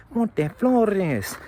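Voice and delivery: high squeaky mimicry of a little kid; mock a tiny tough kid's squeak. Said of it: "he had a little squeaky ass voice".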